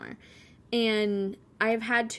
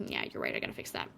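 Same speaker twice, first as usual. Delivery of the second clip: defeated mumble; 9 dB quieter, faster than usual. Retold or imitated. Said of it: "And I'll be like".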